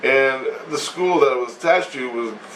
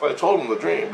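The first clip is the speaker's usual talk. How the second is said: usual speaking voice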